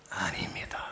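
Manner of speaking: soft breathy whisper; whispered denial. Real, not acted. Pastor whispering a correction to the congregation. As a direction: drop to a whisper.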